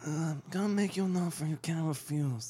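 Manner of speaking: mumbled movie godfather voice; Marlon Brando. Acted. Podcaster taking a turn at impressions, Brando godfather voice. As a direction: put on an ageing mob boss's voice. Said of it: "do Marlon Brando impressions".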